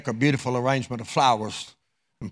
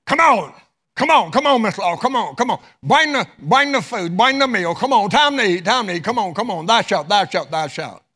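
high, loud impatient calling to the kitchen; hollering; 6 semitones higher, 5 dB louder than usual. Acted. Husband hollering at his wife in the kitchen, loud and impatient. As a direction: yell for the wife to bring the food. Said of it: "he hollers to his wife".

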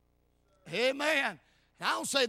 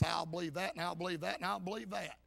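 lower, softer checklist listing; perfunctory recital; 4 semitones lower, 4 dB quieter than usual. Acted. Preacher mimicking someone checking off facts, perfunctory recital. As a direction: rattle off beliefs as an empty checklist.

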